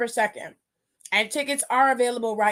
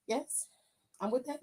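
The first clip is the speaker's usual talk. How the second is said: ordinary delivery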